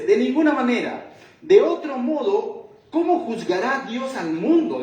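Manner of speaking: preaching at full force; categorical refusal. Retold or imitated. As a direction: thunder the refusal from the pulpit. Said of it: "Entonces dice".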